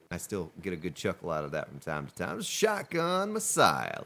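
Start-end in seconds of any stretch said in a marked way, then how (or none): 2.4-4.0 high, slow announcer call; a mock grand introduction. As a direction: draw out the name like a ring announcer. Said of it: "announces store name dramatically".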